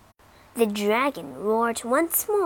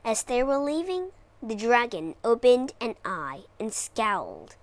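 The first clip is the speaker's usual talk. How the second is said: plain reading voice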